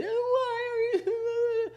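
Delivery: high, slow, sung wail; hammed-up crying, drawn out; mock sobbing. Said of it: "I'm crying".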